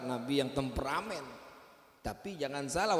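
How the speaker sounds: usual speaking voice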